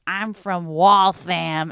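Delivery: slow, loud mock Boston accent; hammed-up Boston accent, drawn right out; bad Boston accent. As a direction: do a badly botched Boston accent. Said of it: "They made his character from Boston".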